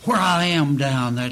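usual speaking voice

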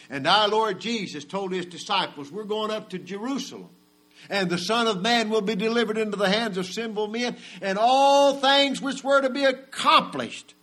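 high, loud preaching cry; preaching at full force. Acted. Preacher driving home the price of sin, full force. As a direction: ring it out to the congregation. Preacher quoting Jesus rising.

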